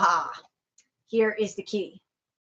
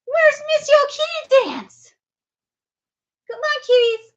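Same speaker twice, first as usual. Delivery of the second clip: higher, slower sing-song call to a cat; 9 semitones higher, 5 dB louder than usual. Real, not acted. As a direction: lift the call up high and slow. Calling the cat twice.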